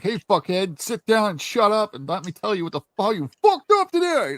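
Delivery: a hint of a sergeant chewing out a recruit; drill sergeant. Marines dressing down a recruit, mock sergeant bark. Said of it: "Marines were like".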